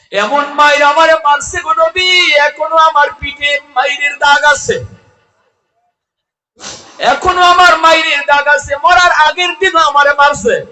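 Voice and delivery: high, loud chanted lament; crying wail. Acted. Mother telling the Prophet of her beatings, loud wailing chant. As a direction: wail it out in preacher's song. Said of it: "মায় কান্দে আর কই".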